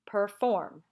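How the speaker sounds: per-FORM, second syllable hit harder; emphasis on FORM. Shown as a demonstration. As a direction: exaggerate the FORM in Perform. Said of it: "there's more emphasis on the form".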